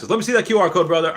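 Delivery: eager streamer shout-out; excited hype. Real, not acted. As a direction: hype up the viewer's QR code. Hyping a viewer's raid.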